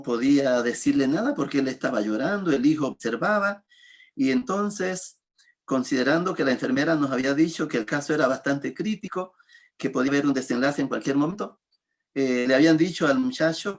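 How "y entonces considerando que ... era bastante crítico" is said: usual speaking voice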